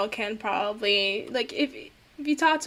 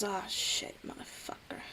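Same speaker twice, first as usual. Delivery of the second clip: cursing under his breath, a quick muttered swear; muttered curse; 3 semitones lower, 14 dB quieter than usual. Acted. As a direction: mutter the curse almost to yourself. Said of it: "And he goes".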